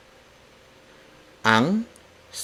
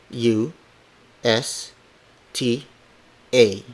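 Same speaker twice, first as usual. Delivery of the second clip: ordinary delivery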